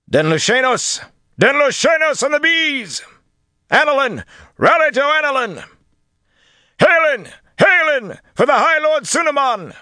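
loud, very high rallying cries; enemy shouts. A narrator's reading. Enemy soldiers rallying amid forest fighting, shouting. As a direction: yell each name as a battle cry. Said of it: "the enemy raised shouts".

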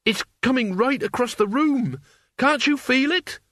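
high, rising panic; a scared boy pleading; terrified. Acted. Mort terrified, mist approaching.

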